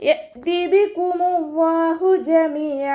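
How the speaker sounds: slow, drawn-out melodic tajweed chant; Quran recitation. Shown as a demonstration. Teacher models melodic Quran recitation for students. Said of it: "teacher recites the verse".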